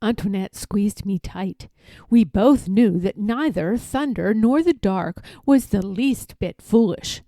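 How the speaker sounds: plain reading voice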